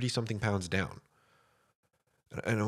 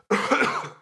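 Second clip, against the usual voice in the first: real wet cough into mic; chesty cough; 11 semitones higher, 7 dB louder than usual. Real, not acted. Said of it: "sorry for coughing in the mic".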